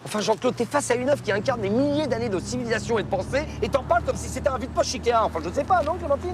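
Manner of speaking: high, indignant scolding; telling off. Acted. Telling Jean-Claude off for mocking an artwork, indignant scolding. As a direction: raise your pitch and lecture him indignantly. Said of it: "c'est pas la peine de m'engueuler".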